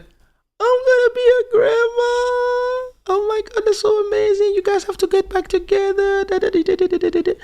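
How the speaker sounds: very high, loud, squealing delight; hammed-up overjoyed grandma; mock shrieking excited mother; ecstatic mom. Mom thrilled about grandchild.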